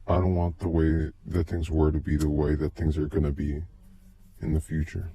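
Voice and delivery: ordinary delivery, nothing marked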